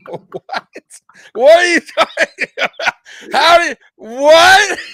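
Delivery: loud, high, slowed disbelief with a laugh; can't believe what he saw; stretched-out laughing outrage; incredulous. Real, not acted. Ranting disbelief at movie.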